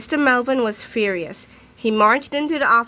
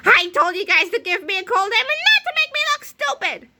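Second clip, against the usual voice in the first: high, angry scream; furious principal screaming at the boys; 11 semitones higher than usual. A narrator's reading. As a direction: scream in outrage. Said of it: "Mister Melvin screamed".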